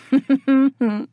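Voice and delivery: a brief mocking laugh; short cruel laugh. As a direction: laugh down at the beaten foe. Laughs after taunting victim.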